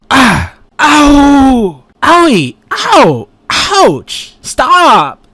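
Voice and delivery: high yelps of hurt; pained cries. Acted. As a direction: yelp each hit, high and loud. Character taking damage.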